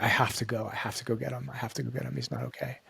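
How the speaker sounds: very soft, understated repeated insistence; hushed, quietly insistent retelling; retold urgent insistence. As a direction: let the quoted worry sink almost to nothing.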